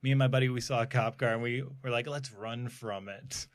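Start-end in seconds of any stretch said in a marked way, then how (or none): 2.1-3.5 hushed plotting. Retold or imitated. Buddies plot, retold.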